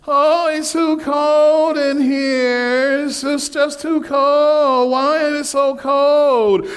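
high, slow whine about the cold; complaining whine. Retold or imitated. Wendy complaining to her husband on the plane, whining. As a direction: drag it out like a spoiled passenger. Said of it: "Wendy begins to complain".

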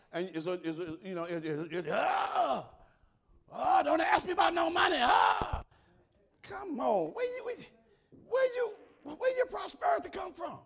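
shouted high and loud in a sudden burst. Acted. Preacher shouting at the congregation about money. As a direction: erupt into a mock shout.

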